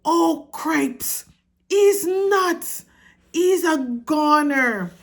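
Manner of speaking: panicked shout. A narrator's reading. Young mouse yelling in panic about his brother. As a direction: yell it in alarm, voice climbing. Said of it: "shouted Furlong again".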